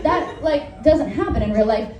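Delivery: ordinary talk, nothing marked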